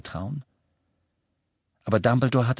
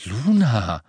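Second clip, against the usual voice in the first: puzzled, drawn-out name; irritated; 4 dB louder than usual. A narrator's reading. Harry startled to see Luna here, irritated. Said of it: "sagte Harry irritiert".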